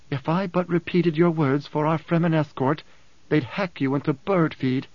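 ordinary delivery, nothing marked

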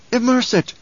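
high, urgent order; commanding. A narrator's reading. Mother orders son to sink the device, commanding. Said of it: "she commanded".

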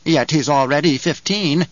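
a hint of a wheezy crone; slightly wheezy old woman voice; lightly voiced old crone. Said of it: "Her voice wheezed and twanged".

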